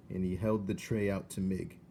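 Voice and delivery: ordinary delivery, nothing marked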